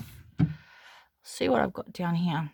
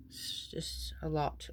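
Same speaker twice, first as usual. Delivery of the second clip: whispering; 2 semitones lower, 13 dB quieter, slower than usual. Real, not acted. Whispered aside, overwhelmed by drawers.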